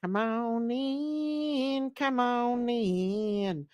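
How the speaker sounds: slow, stretched singsong invitation; drawn-out welcome. Acted. Host singing viewers into her stream, slow and stretched. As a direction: draw every word out like a lazy tune.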